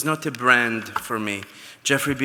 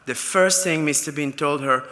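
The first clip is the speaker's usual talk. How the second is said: normal talking voice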